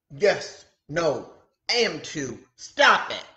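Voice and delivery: a bickering friend yelling stop it; squabbling shout. Acted. Disciples squabbling, voices pile up.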